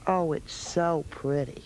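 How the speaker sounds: slow hushed oh it's so pretty; whispered admiration. Real, not acted. Host admiring a toddler's new dress, whispered. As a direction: hush it like you are in awe.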